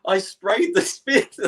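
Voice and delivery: high, slow, strained retelling; mock-choking admission; coughing confession. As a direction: imitate confessing through a coughing fit. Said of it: "I started coughing like".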